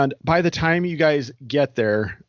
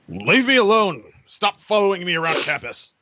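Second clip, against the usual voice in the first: angry outburst; 4 semitones higher than usual. Acted. Fleeing Ancaster turns on his followers, angry outburst. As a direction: snap it out in a raised, agitated voice. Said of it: "he looks back and he goes".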